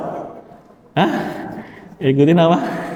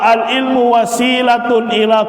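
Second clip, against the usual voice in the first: formal quotation; 7 semitones higher than usual. Acted. Teacher quoting Rabi'ah to the class, formal recitation. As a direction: recite the scholar's words solemnly.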